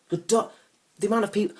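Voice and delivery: loud, high-pitched spluttering; exasperated rant. Acted. Man fed up with dolphin lovers, exasperated. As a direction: splutter in comic outrage.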